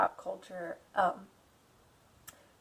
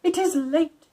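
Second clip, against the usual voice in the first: higher, louder, slow firm count; insistent Dracula; 5 semitones higher, 17 dB louder, slower than usual. Acted. Dracula insists Jonathan is his guest, firm and courtly. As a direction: insist with polite force. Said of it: "but he insisted".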